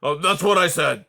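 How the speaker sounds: put-on loud, cross old porcupine; bellowing. A narrator's reading. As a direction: insist loudly he said it. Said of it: "bellowed Aerith".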